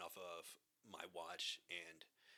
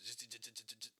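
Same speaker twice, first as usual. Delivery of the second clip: buzzing zzz like a metal detector; detector buzz imitation; 3 semitones higher than usual. Retelling his dive, imitating the detector buzz.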